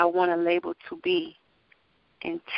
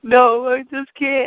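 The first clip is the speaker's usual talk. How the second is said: imitating crying; 6 semitones higher, 4 dB louder, slower than usual. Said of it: "And I was just crying".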